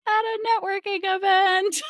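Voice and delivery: high, squeaky mock-amazed reveal; mock surprise. Real, not acted. Host revealing to listeners where they met, mock surprise.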